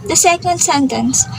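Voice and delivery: ordinary talk, nothing marked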